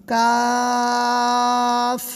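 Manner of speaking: کاف with the long a held very slowly; slow stretched کاف; drawn-out kaaf. Shown as a demonstration. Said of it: "اس کا پڑھنا آسان ہے".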